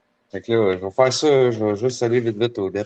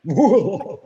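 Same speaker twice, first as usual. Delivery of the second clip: high, cackling mock-villain laugh; evil laugh; 12 semitones higher than usual. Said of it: "it's O T W's evil laugh".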